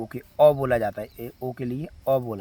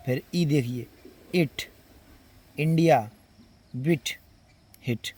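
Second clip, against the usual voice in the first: normal talking voice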